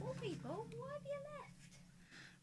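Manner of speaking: mock dismay. Viewer count dropped, mock dismay.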